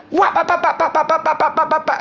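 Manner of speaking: very high rapid-fire whap-bap noises; machine-gun cat swats in falsetto; imitated swiping blows. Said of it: "she swipes the fuck out".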